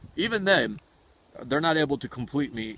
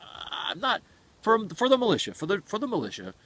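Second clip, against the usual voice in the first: high, emphatic declaring; 9 semitones higher than usual. Retold or imitated.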